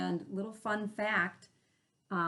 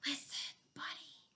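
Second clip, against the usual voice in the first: breathy whispered plea, much softer; 7 semitones higher, 12 dB quieter than usual. Acted. Parents whispering to Buddy, trying to make him listen. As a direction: hush it like a secret. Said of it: "They tried whispering".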